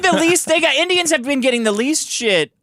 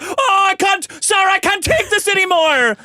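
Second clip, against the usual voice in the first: put-on whiny Indian accent; 12 semitones higher than usual. Comic mocking Indians upset online, high put-on Indian whine. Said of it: "Indians are like".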